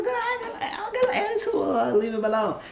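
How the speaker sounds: very high-pitched retold denial; squeaked-up playful protest; mock protest. Acting woman denying an attitude.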